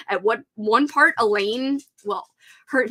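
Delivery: usual speaking voice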